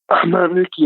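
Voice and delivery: retold demon voice, lightly done; brief imitation of the sinister voice; hint at the creature's spooky voice; super deep voice. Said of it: "in that super deep voice".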